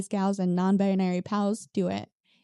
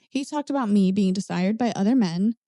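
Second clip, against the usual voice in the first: ordinary talk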